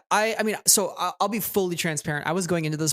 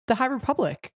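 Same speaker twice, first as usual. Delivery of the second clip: epic fantasy narrator; 9 semitones higher than usual. Pretend. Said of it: "You should voice over for the High Republic show".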